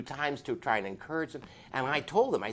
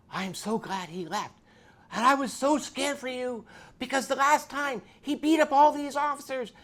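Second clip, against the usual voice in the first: higher and louder, a frightened wife's rush; a hint of the scared wife, higher; lightly voiced wife; 12 semitones higher, 8 dB louder than usual. Wife Dorothy voiced in story.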